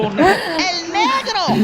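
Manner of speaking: drawn-out, high mock cry; mock exclamation. Comic telling the crowd, a drawn-out high exclamation. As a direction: stretch it out high like a big reveal. Comic voicing a dark Cuban.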